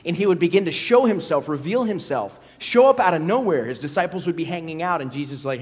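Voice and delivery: ordinary talk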